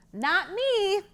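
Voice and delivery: high little goat voice; quick goat denial. Acted. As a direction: brush it off fast. Goat denies.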